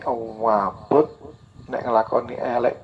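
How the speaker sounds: ordinary talk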